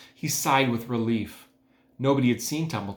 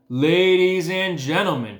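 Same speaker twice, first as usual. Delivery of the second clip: slow, louder announcer call; loudspeaker announcer; 6 semitones higher, 6 dB louder, slower than usual. A narrator's reading. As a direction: boom it over the fairground PA. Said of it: "continued the loudspeaker".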